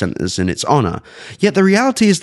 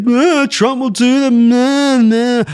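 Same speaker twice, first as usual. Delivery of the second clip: high, drawn-out mocking whine; mocking whiny naysayers; 4 dB louder, much slower than usual. Host mimicking listeners who expect a Trump bailout, mocking whine. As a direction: stretch it out in a silly high-pitched bleat. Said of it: "while some of you may say".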